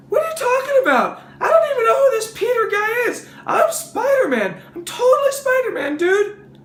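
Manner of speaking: very high, indignant squeal; Spider-Man. Acted. Caller denying his identity on the phone, high indignant squeal. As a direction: protest in a high-pitched, excitable kid voice. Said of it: "Spider-Man makes a phone call".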